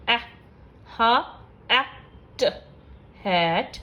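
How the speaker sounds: slow phonics blending with pauses; sounding out hat. Shown as a demonstration. Teacher showing short vowel examples, sound by sound. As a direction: say ए, हा, ए, ट as separate clipped sounds, then blend them into हैट. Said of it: "short vowel के कुछ examples हैं".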